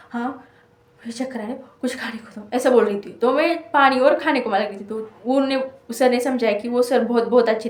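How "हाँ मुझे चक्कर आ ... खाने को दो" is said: softly imitating her dizzy plea, voice almost gone; a drained student asking for food, very soft; weak faint voice. Dizzy in the exam hall, asking for food in a faint voice. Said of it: "मेरा आवाज़ भी नहीं निकल रहा था".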